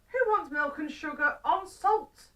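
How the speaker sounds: put-on high, loud, exasperated old man; indignant question. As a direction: protest loudly in disbelief.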